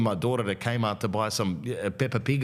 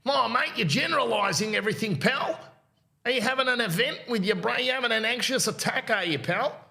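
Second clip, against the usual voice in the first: much higher, squeaky mock voice of a defensive local; mocking Bunbury local; 13 semitones higher than usual. Man from Bunbury scoffing at the generalising, in a high mock voice. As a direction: send up a touchy country bloke. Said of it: "people that are over in Bunbury going".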